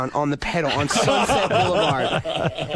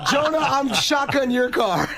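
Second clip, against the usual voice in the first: laughing mock shout; 7 semitones higher than usual. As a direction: bark it out, laughing and amped. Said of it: "And he goes".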